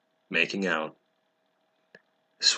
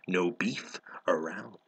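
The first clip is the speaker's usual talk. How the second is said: whispered aside, much softer; 2 semitones lower, 9 dB quieter than usual. Real, not acted. Reader jokes about beef instead of stake, whispered. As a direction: breathe it out as a throwaway joke.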